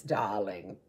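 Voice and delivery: put-on "darling" in an English accent, dropping low. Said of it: "like that with an English accent".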